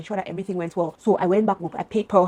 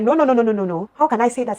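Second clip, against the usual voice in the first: loud, higher rapid-fire denial; imitated screaming protest; 4 semitones higher, 7 dB louder than usual. Friend denying the story, loud imitated outburst. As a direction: act out a friend making a scene. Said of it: "she just started screaming, making a scene".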